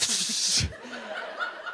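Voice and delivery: airy burst of laughter; breathy laugh. Real, not acted. Wild pee-rig malfunction.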